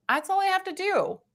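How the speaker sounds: high-pitched realization. Real, not acted. Reader realizing blocking bots is enough, high-pitched relief. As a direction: lift the whole line up high, lightly. Repeats own conclusion for emphasis.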